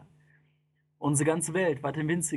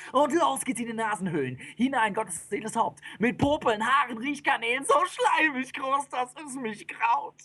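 high, excited storytelling, slowing at the end; horrified epic recital; mounting revulsion; 9 semitones higher than usual. A narrator's reading. Mounting disgusted narration.

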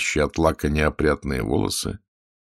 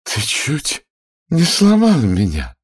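high-voiced smitten lady; high, drawn-out coquettish breathiness; breathless admiration; 10 semitones higher, 4 dB louder, slower than usual. A narrator's reading. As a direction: coo it slowly in a high, admiring voice. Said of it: "тяжело дыша сказала она с восхищением".

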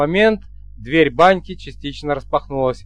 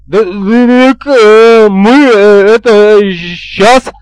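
loud, higher, dragged-out stammer; eager stutterer; 5 semitones higher, 9 dB louder, much slower than usual. A narrator's reading. Interpreter rushing to help the bride, eager stutter. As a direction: burst out loudly, stuttering with eagerness. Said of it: "резко проснулся Заикин".

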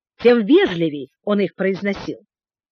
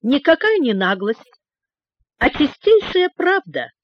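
cheerful; 6 semitones higher than usual. A narrator's reading. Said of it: "весело сказал Джулиан".

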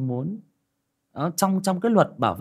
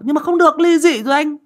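high, loud pleading protest; friend's protest; 10 dB louder than usual. Retold or imitated. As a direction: whine that it's not allowed.

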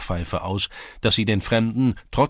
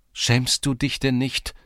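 higher, slow reproach; exclaimed scolding, pitched up; indignant exclamation; 6 semitones higher, 4 dB louder, slower than usual. A narrator's reading. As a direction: cry out in reproach, drawing it out. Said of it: "rief Hanja aus".

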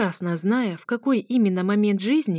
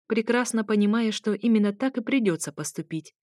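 ordinary delivery

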